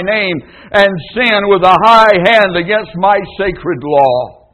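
building, high, loud rebuke; thundering divine reproach; voice of God. Acted. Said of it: "Jehovah says".

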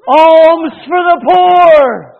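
slow, loud drawn-out plea; beggar's call. Acted. Beggar calling at the gate.

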